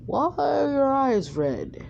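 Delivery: lightly voiced Jiraiya, rising and slow. Jiraiya voiced in retold story.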